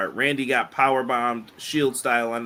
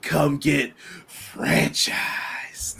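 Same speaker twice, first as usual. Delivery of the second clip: whispering; much slower than usual. Retold or imitated. Quoting wrestler catchphrase, whispered.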